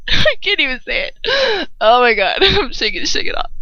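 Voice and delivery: high, real laughing fit; talking through giggles; helpless laughter. Real, not acted. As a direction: crack up while trying to speak. Reader cannot go on for laughing.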